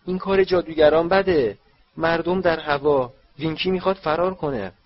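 high, loud, panicked squeal; screeching house-elf. A narrator's reading. House-elf struggles to flee through bushes, screeching. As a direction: squeal it out like a frightened little elf. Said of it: "او جیغ زد".

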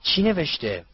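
breathless, impatient asking. Acted. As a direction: ask as if holding your breath. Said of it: "نفسش بند آمده بود پرسید".

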